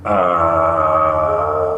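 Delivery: low, held bass note; deep bass voice. Pretend. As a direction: sing one low note. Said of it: "une voix grave".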